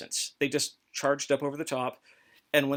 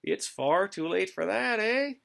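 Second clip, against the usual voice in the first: put-on higher, slower Canadian accent with a polite menace; a Canadian apologizing as he refuses surrender, in Canadian accent; lightly voiced Canadian, eh at the top; mock Canadian accent; 5 semitones higher, slower than usual.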